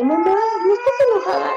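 put-on high, quick little-boy voice; imitated young son. Son checking on his mother's temper, high child voice. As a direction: imitate a child asking timidly in a high pitch. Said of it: "Y ahora es así de".

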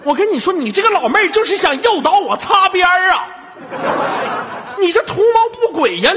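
high, loud indignation; mock-outraged accusation. Host accusing caller of baiting him, mock outrage. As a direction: accuse them with hammed-up alarm.

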